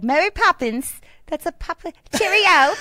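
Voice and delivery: high-pitched Mary Poppins British; mock British accent. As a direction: try a loud, silly British accent. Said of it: "you could do the accent, too".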